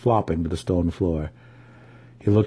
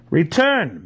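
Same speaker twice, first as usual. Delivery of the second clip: screamed; 9 semitones higher than usual. Acted. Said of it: "Massage screamed".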